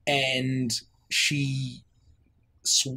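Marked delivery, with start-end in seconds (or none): none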